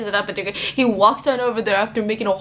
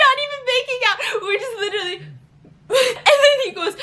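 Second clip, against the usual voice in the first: laughing; 11 semitones higher, faster than usual. Real, not acted. Laughing while retelling.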